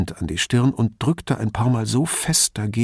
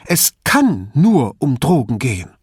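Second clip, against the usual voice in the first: said with firm insistence, a bit louder; emphatic; 4 semitones higher, 6 dB louder than usual. A narrator's reading. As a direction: lean hard into every word. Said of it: "mit Nachdruck".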